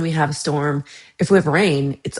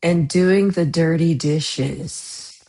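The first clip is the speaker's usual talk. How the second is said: slow, drawn-out innuendo on "dirty dishes"; suggestive; much slower than usual. Shown as a demonstration. Streamer contrasting chores with innuendo, suggestive. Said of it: "there's a big difference between".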